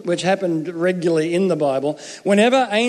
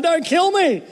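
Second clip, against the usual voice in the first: mock terrified plea; 9 semitones higher than usual. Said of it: "they're going".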